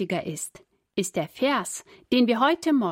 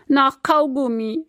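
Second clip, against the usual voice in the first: sobbing; 6 semitones higher, 5 dB louder than usual. A narrator's reading. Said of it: "schluchzt Susi".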